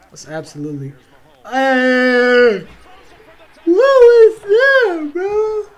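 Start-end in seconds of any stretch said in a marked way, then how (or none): 1.4-2.7 loud, drawn-out yell; excited shout. Real, not acted. Shouting at the game. 3.6-5.6 very high, loud, stretched-out yell; shocked outcry. Real, not acted. Outraged reaction to a play.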